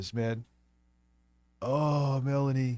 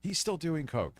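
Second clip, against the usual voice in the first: stuffy voice; 2 semitones higher, 7 dB quieter than usual. Real, not acted. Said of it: "you can hear how he sounds".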